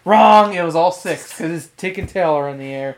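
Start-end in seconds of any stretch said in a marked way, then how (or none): 0.0-0.5 loud, emphatic "Wrong!"; loud buzzer call. Pretend. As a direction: call it like a game show host. Quiz host, wrong answer.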